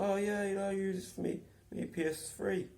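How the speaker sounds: high mock Steve voice; a cheery put-on voice of the man; imitating Steve. Steve showing off his PS3 gear, high mock voice.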